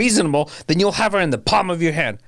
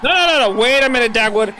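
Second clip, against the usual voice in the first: loud and high, grab his arm with it; no, no, stop him before he charges; frantic shouted no; urgent shout; 6 semitones higher, 4 dB louder than usual. Acted. Stopping Dagwood from attacking man.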